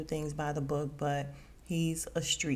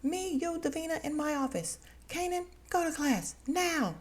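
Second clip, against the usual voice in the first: high, stern mock principal barking names; mock principal orders; 11 semitones higher than usual. Recapping the show, mimicking the principal ordering students. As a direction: call them out like a strict principal.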